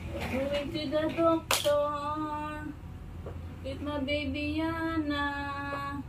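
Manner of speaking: slow, soft singsong; drawn-out lilting coo. Real, not acted. Parent sing-songs to baby.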